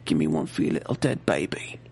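very low, soft, menacing; taunting villain. Retold or imitated. Villain taunts her about her baby, very low. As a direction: growl it low and cruel. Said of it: "he's like".

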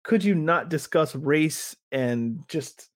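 ordinary talk

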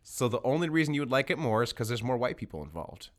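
ordinary talk

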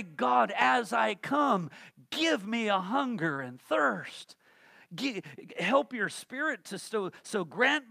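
loud, slow pleading prayer; shouted prayer. Pretend. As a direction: cry out to God. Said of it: "we ought to also pray".

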